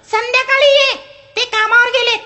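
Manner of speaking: very high, loud and quick; quick lady's reply. Acted. The lady answers the scrap dealer quickly, very high. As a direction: snap back at once. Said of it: "चटकन म्हणाली".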